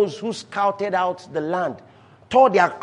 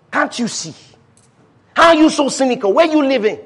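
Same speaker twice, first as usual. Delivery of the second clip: raised, insistent acted protest; impassioned mock challenge to cynics; exasperated exclaiming; 5 semitones higher, 4 dB louder than usual. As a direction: plead with the doubters in a raised voice, building then easing. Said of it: "Sometimes we're talking like that".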